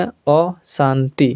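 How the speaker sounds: अशांति slowly with a rounded o, osanti; अशांति said o-shanti, slowed for learners; Bengali pronunciation. Shown as a demonstration. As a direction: lean into the o of अशांति, Bengali style. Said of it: "और बंगाली में".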